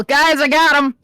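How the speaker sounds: loud, high shout to the team; yell. Acted. Character alerting teammates to the target, loud yell. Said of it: "I'm just gonna yell".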